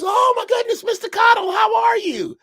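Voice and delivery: overly cheerful preacher caught out, near shout; startled reverend. Retold or imitated. Reverend greeting granddaddy.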